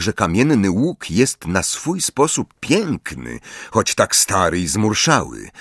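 plain reading voice

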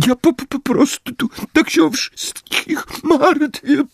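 high, stuttering, tearful; stammering moan. Acted. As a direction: choke on the words. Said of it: "jęknęła pani Wesley".

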